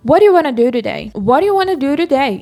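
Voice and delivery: "What do you wanna do today" run together as "wari-wanna-du-riday", pushed hard; forceful, blended "What do you wanna do today"; exaggerated connected speech. Shown as a demonstration. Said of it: "Eu tô forçando bastante aqui".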